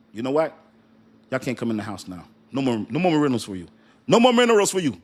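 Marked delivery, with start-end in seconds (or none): 2.5-3.7 fast, clipped-off refusal; fast teasing no; quick mock refusal. China voiced cutting off minerals. 4.1-5.0 high, loud shouted refusal; big hammed-up no; mock shout. China voiced cutting off minerals.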